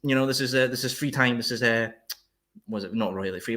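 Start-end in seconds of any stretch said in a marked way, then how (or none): none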